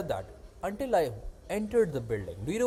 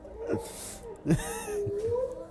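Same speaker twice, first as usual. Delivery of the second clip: long, squealing, helpless laughter; high wheezing laugh; 5 dB quieter than usual. Real, not acted. Friend hears he was a prostitute, high helpless laughter.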